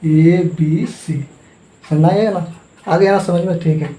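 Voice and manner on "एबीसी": slow spelled out. Shown as a demonstration. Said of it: "तो कैसे कीजिएगा?".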